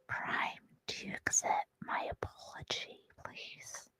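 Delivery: very soft, pleading whisper; whispered apology. Pretend. Streamer begging Canadian viewers to forgive the lapse, whispered apology. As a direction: shrink down to a tiny whispered plea.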